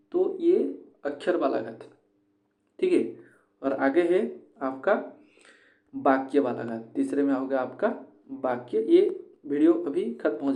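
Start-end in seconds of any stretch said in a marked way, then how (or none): none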